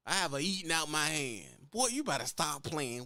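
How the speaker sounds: usual speaking voice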